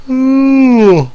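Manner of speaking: very high loud drawn-out ooh; high yawning ooh. Real, not acted. Tired player yawning mid-game, loud high ooh. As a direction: let out a huge high yawn. Yawn-like howl.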